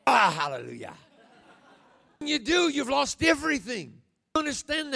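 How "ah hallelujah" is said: laughing hallelujah. Real, not acted. Preacher exclaims.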